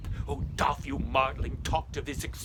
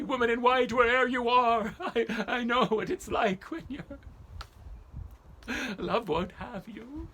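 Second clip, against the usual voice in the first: calling out; 8 semitones higher than usual. Acted. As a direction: call out sadly to the ghost. Calling out to the ghost.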